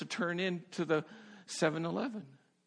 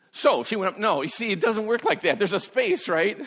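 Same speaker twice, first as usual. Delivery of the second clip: loud, higher, insistent correction; emphatic correction; 7 semitones higher, 8 dB louder than usual. Real, not acted. Preacher loudly correcting his own story. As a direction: cut in loudly to set the record straight.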